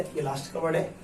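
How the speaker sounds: ordinary talk, nothing marked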